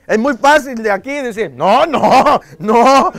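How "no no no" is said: loud higher insistent no, no, no; mock firm no. Parent pretending to refuse expensive shoes, loud mock no. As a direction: pretend to refuse firmly, hammed up.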